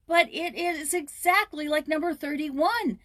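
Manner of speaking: high, loud, slow insistence; insistent. Acted. Joyce insisting to the old man, high insistent voice. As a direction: press the point hard. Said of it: "persisted Joyce".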